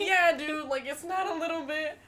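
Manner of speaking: high, loud, laughing reply. Retold or imitated. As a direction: talk through the giggles.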